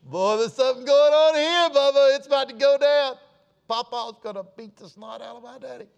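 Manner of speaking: put-on a teenage boy sure a fight is about to break out; excited kid. Young teen watching grandpa confront his dad, squealing with excitement. Boy's thrilled inner voice, fight coming.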